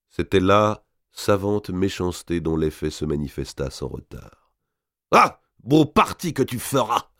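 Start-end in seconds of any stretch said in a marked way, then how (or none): 5.1-7.1 high, loud scornful countess; the countess mocks her maid's wish to marry; mocking exclamation. A narrator's reading. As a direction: jeer at the maid's marriage plans. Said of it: "s'écria Mao".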